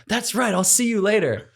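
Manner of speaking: high, chirpy so-long; mock breezy goodbye. Bidding Captain Lou goodbye, high and chirpy. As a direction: sing it out like a cheery farewell.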